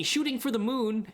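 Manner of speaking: a hint of a Gru impression; lightly voiced cartoon villain. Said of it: "Gru from Despicable Me".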